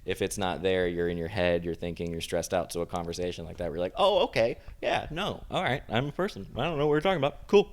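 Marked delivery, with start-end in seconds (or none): 4.0-7.7 high, airy mock daze, lost the thread; dazed party voice. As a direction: play someone cheerfully lost mid-chat. Mock dazed party-goer quote.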